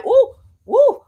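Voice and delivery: high, loud startled ooh woo; excited whoop. Real, not acted. Reader feels her guides push her, loud high whoop. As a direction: yelp as the energy hits. Sudden jolt from spirit guides.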